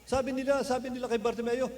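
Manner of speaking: ordinary talk, nothing marked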